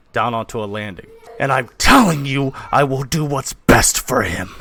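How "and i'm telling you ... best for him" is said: loud, emphatic insistence; heated father overruling his wife; arguing father. Acted. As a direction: lay down the law, punching key words. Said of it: "you hear the argument".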